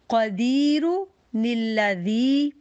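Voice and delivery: कदीरु निल्लज़ी recited very slowly, nun joined to the next word; slow Quranic recitation. Shown as a demonstration. Teacher demonstrates recitation rule to her students. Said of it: "अब हमने इसको कैसे पढ़ना है".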